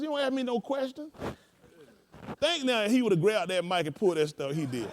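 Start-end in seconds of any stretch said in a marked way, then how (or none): 0.0-1.1 high voice rising on "ask"; mock-pompous retort. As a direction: lightly imitate the offended bishop. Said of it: "He said".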